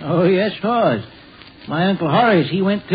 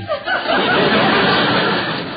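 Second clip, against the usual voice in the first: high giggle. Acted. Gus laughs at own story.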